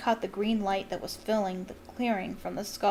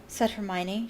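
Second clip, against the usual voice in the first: usual speaking voice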